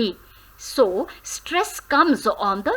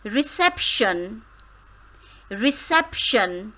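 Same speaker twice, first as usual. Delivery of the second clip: reception stressed on sep; reception, lean on the middle sep; syllable stress demo; 5 dB quieter than usual. Shown as a demonstration. Teacher modelling word stress, reception with sep stressed. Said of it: "How many syllables".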